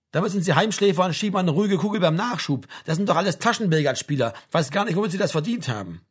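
a touch of grudging congratulation; Spieß. Acted. Sergeant envies Frank's cushy posting. (leicht polternder Spieß, höher und lauter)

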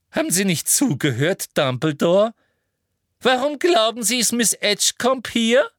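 high, loud, pushy woman's voice; indignant Umbridge. Acted. Umbridge rebukes Dumbledore for not listening, indignant. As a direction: climb high in impatient questioning.

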